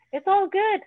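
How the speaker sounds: high, loud cheerful reassurance; cheerful it's-all-good to the audience; upbeat reassurance. Real, not acted. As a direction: lift them up brightly. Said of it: "reassuring listener".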